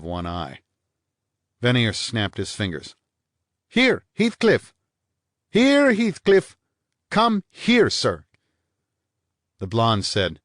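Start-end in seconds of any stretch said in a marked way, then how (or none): 3.7-8.2 much higher and louder, slow and drawn out; calling a dog. Acted. Vanier calls his spaniel across the pool, loud.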